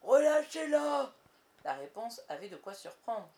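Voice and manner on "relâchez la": bellowed captain's command; Crochet. A narrator's reading. Peter ordering the prisoner freed, shouted captain's command. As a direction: shout the order high, loud and drawn out. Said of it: "imiter la voix de Crochet".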